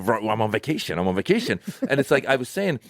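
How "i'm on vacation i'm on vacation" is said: lower, muttered stressed American. Shown as a demonstration. Comedian mimicking stressed Americans on vacation, tense and low. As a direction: say it tense and joyless, pitched down. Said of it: "we're stressed out. We're stressed people".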